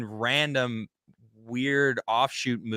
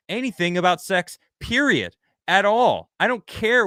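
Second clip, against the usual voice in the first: pissed off; 6 semitones higher, 3 dB louder than usual. Real, not acted. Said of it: "Sorry, I get really pissed off".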